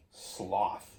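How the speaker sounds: usual speaking voice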